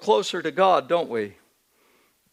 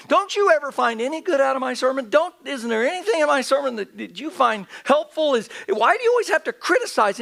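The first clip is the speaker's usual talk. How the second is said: higher, pleading and irritated; a frustrated pastor's hurt outburst; exasperated complaint; 7 semitones higher than usual. Retold or imitated. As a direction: let the frustration spill out at him. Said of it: "I flushed with anger at him".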